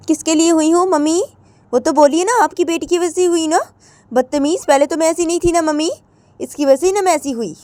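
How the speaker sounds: it's all her fault, mama; whiny complaint. Acted. Radhika complaining to mother-in-law, high and whiny.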